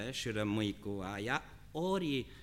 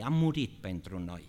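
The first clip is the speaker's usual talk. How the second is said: normal talking voice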